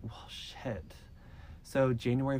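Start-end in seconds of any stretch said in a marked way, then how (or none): 0.0-0.9 a whispered, defeated curse; hopeless whispered aside; resigned whisper. Retold or imitated. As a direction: breathe it out under your breath. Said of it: "So she was like".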